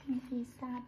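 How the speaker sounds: ordinary delivery, nothing marked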